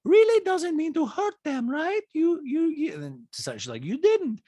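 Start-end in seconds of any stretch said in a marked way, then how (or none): 0.0-2.9 very high mimicking voice; Diana. Diana excusing clumsy words to camera, high mock impression. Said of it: "and this is a talking". 3.8-4.4 high-pitched put-on impression; Diana. Diana giving her opinion to Sutton, high mock impression. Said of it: "Diana goes".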